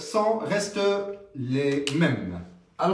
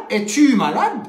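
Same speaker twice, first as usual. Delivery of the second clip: Es-tu malade said as a question, a bit louder; inverted question; 5 semitones higher, 7 dB louder than usual. Shown as a demonstration. Teacher demonstrating inversion to the class, louder. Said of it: "on dit".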